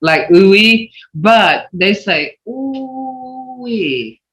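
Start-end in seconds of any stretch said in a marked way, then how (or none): 2.5-4.2 Ooh-wee, long falling tone, softer. Shown as a demonstration. Teacher demonstrating the elders' falling ooh-wee tone. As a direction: draw out Ooh-wee and let it fall softly. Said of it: "Hear that tone?".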